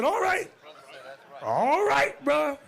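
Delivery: high, amused "all right"; laughing along. Real, not acted. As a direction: humor him while laughing. Bishop humoring him.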